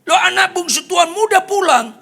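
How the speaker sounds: high, loud breaking news; excited servant. Retold or imitated. Servant telling elder son of return, excited. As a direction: blurt it out at the top of the voice.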